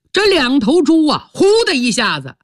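usual speaking voice

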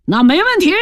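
high, eager promise; ready agreement. A narrator's reading. Squad leader agreeing to give supplies, eager. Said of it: "马上就说".

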